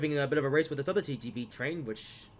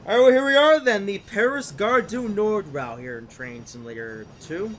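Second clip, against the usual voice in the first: upbeat video intro; 7 semitones higher, 11 dB louder, slower than usual. Acted. Cheery intro to the route.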